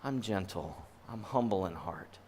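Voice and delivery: soft, low, soothing reassurance; gentle and humble. Acted. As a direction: settle it down quietly, unforced. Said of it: "Do you hear the difference".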